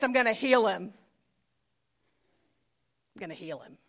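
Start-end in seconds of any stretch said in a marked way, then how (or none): 3.1-3.8 much softer, lower restatement; hushed repeat. Acted. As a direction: let it sink in, low and very soft. Same promise repeated softly after pause.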